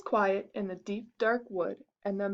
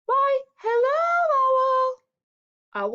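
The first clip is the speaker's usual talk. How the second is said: very high, loud, stretched-out hello; high-pitched, slow mouse call; greeting mouse; 13 semitones higher, 6 dB louder, much slower than usual. Acted. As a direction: draw out a squeaky greeting.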